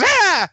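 high startled shriek; hammed-up fright scream; mock scream. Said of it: "and then goes".

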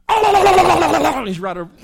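a big gobble right on top of you; loud close gobble. Shown as a demonstration. Showing the congregation a gobble from right overhead, loud and high.

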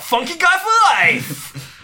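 high, long-drawn cry of a slogan; comic climactic shout; mock triumphant shout. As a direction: yell it out high, stretching the words. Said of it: "I've gotta shout".

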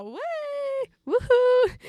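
high, loud "woohoo" whoop; tipsy party cheer. Retold or imitated. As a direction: cheer like someone running around drunk. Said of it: "springer runt och bara". (fylleglatt tjut)

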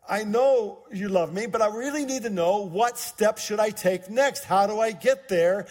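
pressing for the next step; insistent pleading. Retold or imitated. Young man answering God's voice, insistent. Retold insistent reply in prayer.

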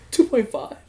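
can't believe the number; disbelief. Retold or imitated. Shocked at his own hours.

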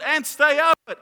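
high, loud imitation of mum; mum yelling. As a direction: shout a warning as his mother. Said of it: "She yells out".

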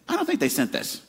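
high-pitched doubtful squeak; sceptical, mock-suspicious; doubtful reader. Friend reading a text not from its sender, doubtful. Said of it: "you're like".

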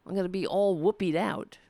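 normal talking voice

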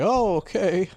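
high, loud fake surprise; mock delight. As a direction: squeal a delighted oh okay. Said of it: "So I was gonna say".

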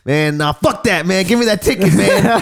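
high-pitched, pushy retold demand; heated demand. Scalper hassling a fan for a free ticket, heated mock demand.

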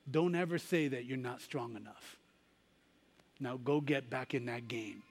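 quiet, loving mother's charge; tender mother. Retold or imitated. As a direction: smile through the pain and lift him up. Bloodied mother encourages her son.

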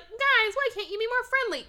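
high, fast pleading; whiny Casper. Retold or imitated. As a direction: plead with the uncles, high and quick. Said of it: "Casper's like".

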